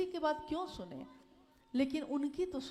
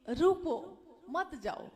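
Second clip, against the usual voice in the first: "रुको" as a raised command, pause, then "मत जाओ"; pause after रुको; 3 semitones higher, 5 dB louder, slower than usual. Shown as a demonstration. Speaker showing the intended message to a departing person, pause after रुको. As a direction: stop at रुको, then say "मत जाओ" as its own phrase. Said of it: "तो मैं कहूँगा".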